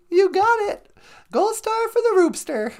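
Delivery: chirpy robot sidekick offering a ride home; high, squeaky sidekick voice. Pretend. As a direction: squeak it like a tiny robot pal. Voicing the companion.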